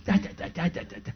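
quiet rapid-fire babble; soft mock stream of repeated syllables; imitated speaking in tongues. Husband imitating wife in tongues for hours, quiet babble. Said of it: "she was in tongues".

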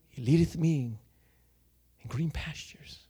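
softly whispered scripture, barely voiced; hushed psalm recital. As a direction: breathe the verse out like a private prayer.

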